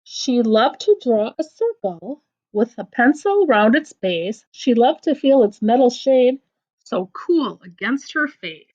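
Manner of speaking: lively storybook reading with voiced bits; picture-book read-aloud; reading to children. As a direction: read the silly poem to a child. Reads a funny rhyme book aloud.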